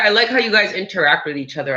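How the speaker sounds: usual speaking voice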